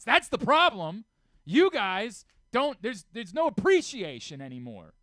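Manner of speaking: hammed-up meltdown at the podium, building to a yell; Dabo Swinney rant. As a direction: scream it at the reporters like a coach who has had enough. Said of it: "Losing his mind".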